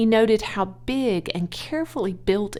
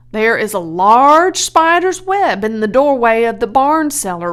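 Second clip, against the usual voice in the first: awed proclamation; 4 semitones higher, 9 dB louder than usual. Acted. Zuckerman tells Edith of the sign, loud and awestruck. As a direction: proclaim the miracle.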